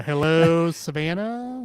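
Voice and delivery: comic hello, rising high at the end; loud hello, then lift the name high; playful Carlin-style name bit; George Carlin. Retold or imitated. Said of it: "so great about ... Carlin".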